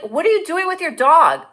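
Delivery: high, loud re-enacted yell; retold yell. Flight attendant to dog's owner in a story, yelling. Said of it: "I yelled at him".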